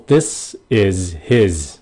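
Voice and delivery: slow "This is his", leaning on the buzzing z of is and his; emphasized Z. Shown as a demonstration. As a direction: stretch a clear Z in "is his". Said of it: "mình cố tình mình nhấn mạnh cái âm Z".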